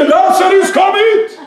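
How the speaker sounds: loud, high preacher's cry of command; big ringing summons; commanding shout. Retold or imitated. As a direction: call it out hard, as if to wake the dead. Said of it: "Han ropte".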